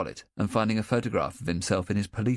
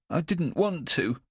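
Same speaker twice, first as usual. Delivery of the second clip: tearful; 9 semitones higher than usual. A narrator's reading. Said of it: "a few tears trickle down his face".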